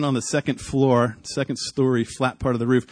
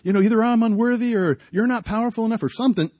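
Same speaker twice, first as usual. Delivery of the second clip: high, raised cry upward; retold yell to God; 8 semitones higher than usual. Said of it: "So I yell out".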